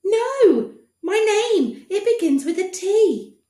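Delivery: put-on high eager boy voice; correcting boy. Boy to the doctor, high eager voice. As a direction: correct him patiently.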